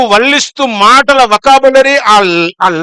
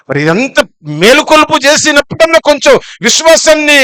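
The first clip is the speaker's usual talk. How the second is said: ordinary talk, nothing marked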